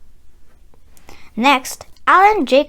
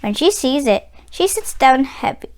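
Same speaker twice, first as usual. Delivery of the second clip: plain reading voice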